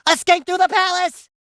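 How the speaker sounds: high, urgent cartoon call; rallying cry. Character rallying friends to flee the palace, urgent cry. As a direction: call out the escape plan with gusto. Character calls out the new plan.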